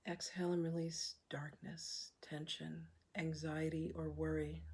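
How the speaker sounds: soft, unhurried meditation voice with long pauses; hushed, calm breathwork leader; guided meditation. Acted. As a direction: guide them gently through each breath, leaving room to breathe.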